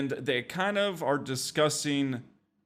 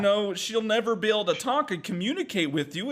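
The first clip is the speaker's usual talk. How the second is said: high exasperated plea; 9 semitones higher than usual. Retold or imitated. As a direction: mockingly beg everyone to calm down. Acting out friend calming group.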